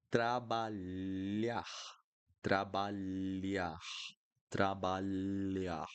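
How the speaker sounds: trabalhar slowly, lh like the lli in million; Portuguese lh. Shown as a demonstration. Teacher drills trabalhar slowly, lh like million. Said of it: "you're going to pronounce like this".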